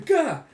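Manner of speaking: high, loud cry of effort; startled "gah". Acted. Yor dodges the swordsman's slash, startled cry. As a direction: yelp while dodging a blow. Acts fighter dodging a slash.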